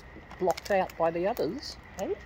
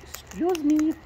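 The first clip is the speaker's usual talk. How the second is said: high squeaky voice; 9 semitones higher, slower than usual. Real, not acted. Talking to a bird on her arm.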